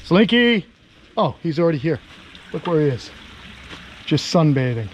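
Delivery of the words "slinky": calling out. Real, not acted. Calling the lizard by name.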